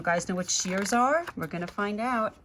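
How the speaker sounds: ordinary delivery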